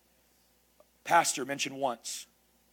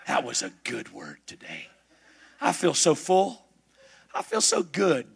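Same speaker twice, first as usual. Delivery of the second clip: lightly voiced satisfied churchgoer; a hint of a smug, well-fed listener; mock churchgoer; 3 semitones lower than usual. Preacher mocking a well-fed churchgoer, lightly voiced. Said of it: "so that you can just leave".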